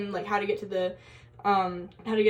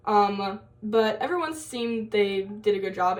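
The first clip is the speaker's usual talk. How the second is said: ordinary talk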